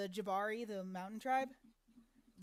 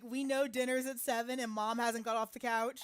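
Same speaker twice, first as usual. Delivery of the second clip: ordinary talk, nothing marked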